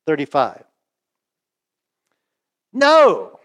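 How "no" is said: sharp, high, loud shout of denial; emphatic no. Acted. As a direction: fling out the no like a cornered man. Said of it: "Peter's emphatic at this point".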